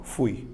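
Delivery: "fui" as one short glide, FUY; one syllable. Shown as a demonstration. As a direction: say "fui" in a single beat. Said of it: "uma vez só".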